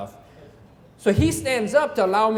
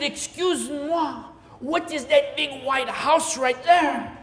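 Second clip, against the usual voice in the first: slow, higher mock French accent; 5 semitones higher, slower than usual. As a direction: stretch it out like a tourist from France. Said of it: "I am from France".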